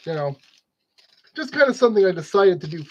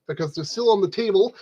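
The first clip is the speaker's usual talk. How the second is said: ordinary delivery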